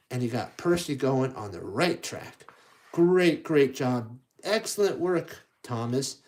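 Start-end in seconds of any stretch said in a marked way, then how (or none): none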